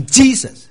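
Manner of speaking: JESUS punched loud and high, "JEE-sus"; exaggerated English "JEE-sus"; English pronunciation. Shown as a demonstration. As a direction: hit the first syllable of Jesus hard, English style. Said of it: "最不像的是英文".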